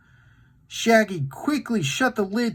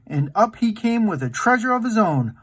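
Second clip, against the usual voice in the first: ordinary delivery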